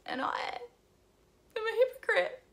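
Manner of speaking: high, tearful confession pushed out between sobs; real crying. Real, not acted. Vlogger admitting her anorexia to viewers, crying. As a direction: force the words out through tears. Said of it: "I'm just gonna cry".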